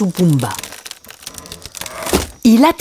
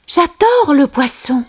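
high-pitched character outburst; delighted exclamation; 8 semitones higher than usual. A narrator's reading. Simba hears about fish, high exclaiming voice. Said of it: "s'écria Simba".

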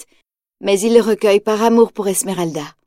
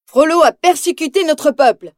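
rallying cry; 5 semitones higher than usual. A narrator's reading. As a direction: rouse the people of Paris. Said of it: "il crie aux Parisiens".

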